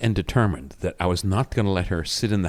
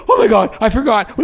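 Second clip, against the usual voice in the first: high, loud flustered exclamation; mock panic; 11 semitones higher, 6 dB louder than usual. Husband imitating wife realising the bacon burned, flustered. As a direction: imitate someone suddenly remembering in alarm.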